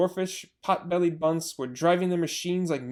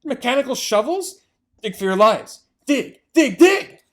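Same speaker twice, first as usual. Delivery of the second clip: high, louder and louder urgent cries; frantic shouting; 7 semitones higher, 9 dB louder than usual. Acted. Mr Fox urging his family to dig, frantic shouts. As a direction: yell at them to dig.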